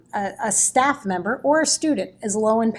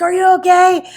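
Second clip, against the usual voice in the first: louder, higher alarmed check-in from the doorway; a teacher bursting in, asking loudly and urgently; concerned colleague; 9 semitones higher, 11 dB louder than usual. Retold or imitated. Teacher across the hall rushing in, loud alarmed concern. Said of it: "she's like".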